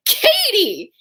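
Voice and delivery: high mock-scolding cry of a name; scandalized exclamation. Friend scolds her for gloating, high scandalized cry. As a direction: call out the name in shocked reproach.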